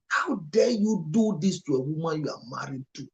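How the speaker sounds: real tears breaking into an accusation; crying out at the husband's cruelty; choked, tearful reproach. Real, not acted. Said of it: "I'm getting very emotional".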